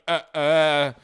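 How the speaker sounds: loud, lost-for-words uhs; caught-out stammer. Retold or imitated. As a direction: imitate someone suddenly found out. Imitates caught hacker stammering.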